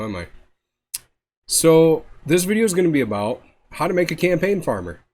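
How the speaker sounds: ordinary talk, nothing marked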